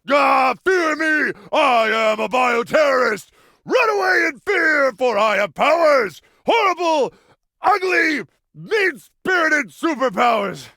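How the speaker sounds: hammed-up, high villain bellow; over-the-top menacing shouts; mock supervillain. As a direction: proclaim it like a cartoon bioterrorist, drawn out. Hammy bioterrorist villain act.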